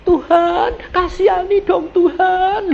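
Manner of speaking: high-pitched imitated plea to God; pleading prayer. Family prays for sick mama, high mock pleading. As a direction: mimic a relative begging for mercy, voice held high.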